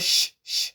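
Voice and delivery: "sh" as a drawn-out hushing hiss; sh sound. Shown as a demonstration. Teacher contrasting the wrong sound with C H, hushing "sh". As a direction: let "sh" hiss on with no stop. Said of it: "not sh".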